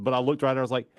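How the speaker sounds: ordinary delivery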